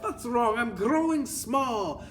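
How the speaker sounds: very high, drawn-out mock alarm; hammed-up high child's cry. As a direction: wail like a bewildered shrinking boy. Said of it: "A boy who was extremely tall cries out".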